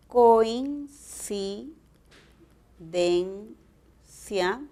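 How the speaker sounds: coincidencia dragged out syllable by syllable; very slow syllabified word. Shown as a demonstration. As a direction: spell coincidencia out slowly by syllables to show both diphthongs. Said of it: "también tiene diptongo". (coincidencia muy lento, sílaba por sílaba)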